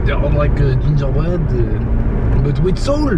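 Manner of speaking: put-on hammy French accent. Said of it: "Can you stop with the French accent?".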